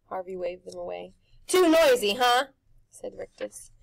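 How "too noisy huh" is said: loud, high showman pitch; pushy grinning tempter; Rictus's brash voice. A narrator's reading. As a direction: sell it like a carnival barker.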